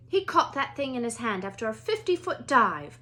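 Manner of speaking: clipped headmistress asking politely; a stern older Scottish witch at a classroom door; McGonagall voice. Acted.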